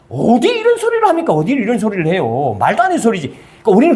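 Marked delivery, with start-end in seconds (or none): 0.1-1.3 "합니까" flung out much higher in disbelief; incredulous exclaim. Acted. Preacher scolds the congregation for boastful oaths, high incredulous outcry. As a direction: throw the question up high, scandalised.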